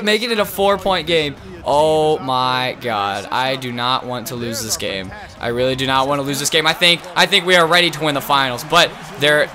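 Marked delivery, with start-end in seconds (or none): none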